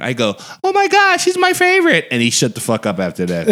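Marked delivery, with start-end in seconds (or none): 0.6-2.1 very high-pitched fan squeal; mock gushing. Comeback to a needling friend, mock gushing. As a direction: gush in an exaggerated high voice.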